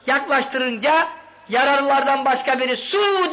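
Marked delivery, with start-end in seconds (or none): 2.8-3.3 a wounded man's high, thin cry for water; retold cry for water. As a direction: call out "Su!" high as a dying man would. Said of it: "diye bağırdı".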